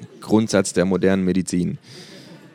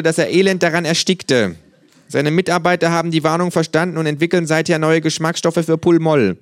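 higher, earnest conspiracy-tale voice; kangaroo storyteller; 8 semitones higher, 4 dB louder than usual. Acted. Kangaroo telling flatmate of a murdered doctor, dramatic tale. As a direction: build dramatically toward the shocking reveal.

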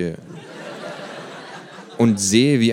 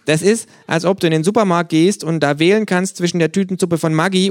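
higher, louder kangaroo ranting; a worked-up kangaroo tirade, pitched up and loud; 11 semitones higher, 8 dB louder than usual. Acted. Kangaroo rant.

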